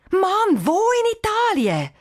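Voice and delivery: high, loud exasperated cry; calling out loud. Acted. Cheyenne shouts at the message from Janik, loud. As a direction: yell at the phone in disbelief. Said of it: "ruft laut raus".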